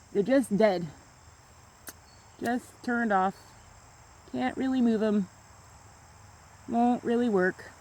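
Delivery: tired, quiet words after an episode; exhausted. Real, not acted. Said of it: "uh-oh, I'm starting to have an episode".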